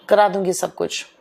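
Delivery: ordinary talk, nothing marked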